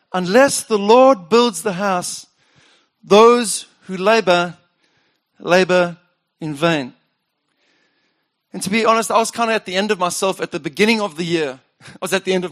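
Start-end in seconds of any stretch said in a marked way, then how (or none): 0.1-6.9 slow, weighty reading of the verse; slow solemn scripture. Shown as a demonstration. Preacher recalling the floor inscription, slow and solemn. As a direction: let each phrase land slowly. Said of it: "I scribed it on there".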